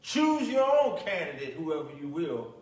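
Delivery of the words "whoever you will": ordinary talk, nothing marked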